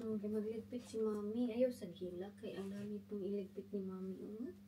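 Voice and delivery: soft tidying chatter; quiet aside. Real, not acted.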